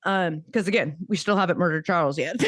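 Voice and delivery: ordinary delivery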